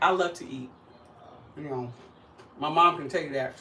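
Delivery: normal talking voice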